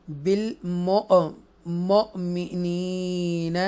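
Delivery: slow chanted tajweed reading; measured chanted recitation; Quranic recitation. Shown as a demonstration. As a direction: recite it slowly and melodically. Recites verse in lesson.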